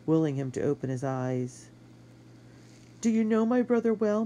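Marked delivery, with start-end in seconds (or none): 3.0-4.3 woman voice. Acted. Woman's voice, high.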